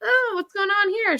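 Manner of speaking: very high put-on nosy voice; mock curiosity. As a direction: squeal it as a suspicious onlooker. Acting out Luna's curious suspicion.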